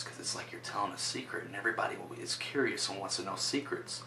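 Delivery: hushed whisper, as if telling a secret. Shown as a demonstration. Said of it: "you drop your voice down real low like this".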